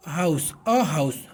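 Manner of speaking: अ हाउस said slowly with a gap after अ; slow model phrase. Shown as a demonstration. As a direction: leave a small pause between अ and हाउस. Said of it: "बीच में gap है".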